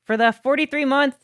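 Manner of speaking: high, loud happy thank yous; excited thanks. Real, not acted. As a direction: cheer the donor on. Thanking subscriber, hyped.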